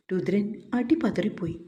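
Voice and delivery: ordinary delivery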